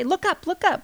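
put-on high, light call to the crawling baby. Mom warning her crawling baby off the wall, high call. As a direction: pitch it up and call out to warn him. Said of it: "And I always say".

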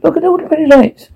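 very high, quick character voice; distorted Poker. A narrator's reading. Poker repeating the pretty lights line, very high distorted voice. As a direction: squeak it out fast and very high. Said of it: "Poker distorted".

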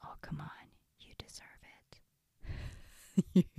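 whispered coaxing, slow and hushed; sneaky whisper. Acted. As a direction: whisper temptation into the listener's ear. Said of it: "almost like it's whispering in your ear".

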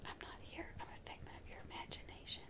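ghostly unvoiced whisper. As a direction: breathe it like a ghost. Pretending to be imaginary.

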